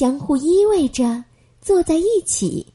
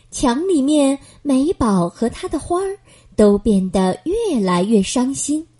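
ordinary delivery, nothing marked

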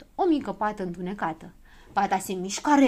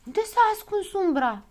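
high-pitched worried question; uneasy; 10 semitones higher than usual. A narrator's reading. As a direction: ask with mild alarm. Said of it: "se neliniștiră toți".

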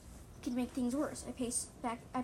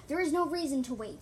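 loud, raised retort; sharp yell; 4 semitones higher, 8 dB louder than usual. A narrator's reading. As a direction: refuse sharply at volume. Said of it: "Singepaw yelled sharply".

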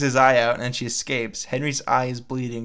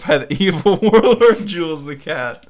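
higher and louder, breaking into laughter; laughing; 6 semitones higher, 7 dB louder than usual. Real, not acted. Reading the fan fiction aloud to a friend, cracking up. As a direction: laugh through the villain's name.

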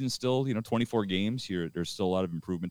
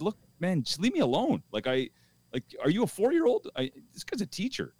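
high, exasperated retort at a rude man; pitched-up disbelief, barely holding back; 10 semitones higher than usual. Retold or imitated. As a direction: push him off, fed up and incredulous. Retold heated quarrel.

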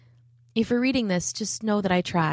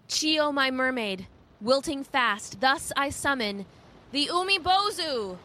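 slow, high roaring chant; roared haiku; 7 semitones higher than usual. A narrator's reading. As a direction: roar it into the wind. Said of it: "roared it into the rising wind".

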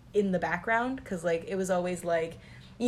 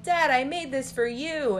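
high, eager child's voice, lightly played; a kid proudly handing over a gift; little girl showing dad her necklace, eager; 7 semitones higher than usual.

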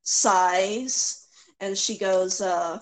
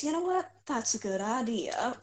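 soft; 3 semitones higher, 6 dB quieter than usual. Acted. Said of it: "and she says".

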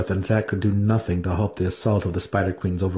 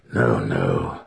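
much lower, quick denial; low wizard denial; 13 semitones lower than usual. A narrator's reading. Wizard reassuring the matron, low. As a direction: wave it away. Alton voiced.

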